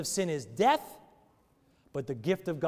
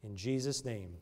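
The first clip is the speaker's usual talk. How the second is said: closing prayer; 3 semitones lower than usual. Acted. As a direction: pray humbly over the congregation. Pastor closes in prayer.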